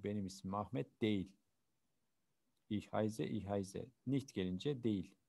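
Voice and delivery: ordinary delivery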